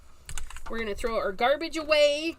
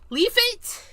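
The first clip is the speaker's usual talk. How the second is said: squeaked pun, much higher and louder; high squeal; 12 semitones higher, 6 dB louder than usual. Real, not acted. Crafter punning on her paper leaf, high squeal.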